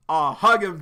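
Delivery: loud, delighted urging; excited cheer. Real, not acted. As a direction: fangirl at the screen. Reader cheering on the characters.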